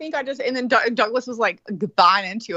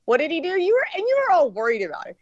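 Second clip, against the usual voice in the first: usual speaking voice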